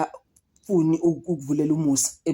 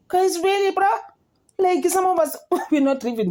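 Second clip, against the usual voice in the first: high, disbelieving really; can't believe what she's seeing; incredulous outburst pitched high; 13 semitones higher than usual. Real, not acted. Incredulous aside to viewers.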